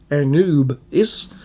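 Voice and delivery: Anubis drawn out slowly as "uh-NOOB-iss"; practised pronunciation. Shown as a demonstration. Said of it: "it's pronounced".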